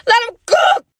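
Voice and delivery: very high, loud, rising cheer; a high hype-man cheer; hyped. Real, not acted. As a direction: egg him on with glee. Repeated, hyped.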